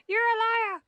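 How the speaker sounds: high, loud playful accusation; mock outrage. Real, not acted. Repeated accusation, much higher.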